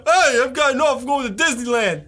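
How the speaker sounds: high, loud put-on teacher voice; goofy teacher voice. Mock teacher boasting about summer plans to students, high and loud.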